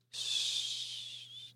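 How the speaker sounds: long, soft "sss"; shushing hiss. A narrator's reading. Leander hushes Lisse during the song, shushing. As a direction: hush with a drawn-out hiss. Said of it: "deed Leander".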